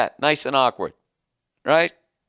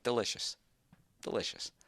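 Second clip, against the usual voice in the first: low, soft, then whispered mock De Niro; De Niro in Goodfellas; 5 semitones lower, 13 dB quieter than usual. Taunting Joe with the stripper's beer, low whispered De Niro. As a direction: savor it quietly like De Niro at the table. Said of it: "the Robert De Niro line".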